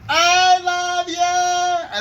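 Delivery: very long, high, loud holler; all-out embarrassing dad yell; yelling out the window. Retold or imitated. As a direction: bellow it long and high across the playground. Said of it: "I yelled out the window again".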